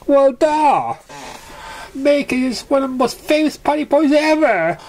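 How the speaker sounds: high, emphatic know-it-all pony voice; exasperated pony. Acted. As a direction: roll your eyes and blurt it. Character exclaims in story.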